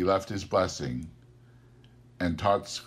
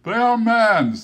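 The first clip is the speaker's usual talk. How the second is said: high, loud grave spirit; 10 dB louder than usual. Acted. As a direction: pronounce it like a judgment. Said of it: "said the spirit".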